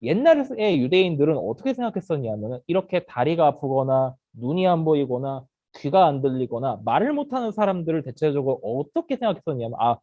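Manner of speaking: ordinary talk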